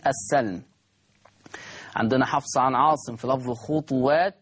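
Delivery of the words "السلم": السلم with an open a on the sin; fatha on the sin. Shown as a demonstration. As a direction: say السلم as as-salm, with a after the s. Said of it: "ورش بفتح السين". (السلم بفتح السين: السَّلم)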